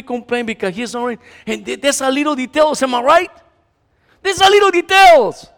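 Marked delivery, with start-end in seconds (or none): 4.2-5.5 louder and higher repeat; emphatic. Real, not acted. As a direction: hammer the point home. Repeating line loud and high.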